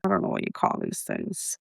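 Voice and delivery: a quick unvoiced aside, hushed; whispered aside. Real, not acted. Notary whispering to herself while searching for the word. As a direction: whisper it to yourself under your breath.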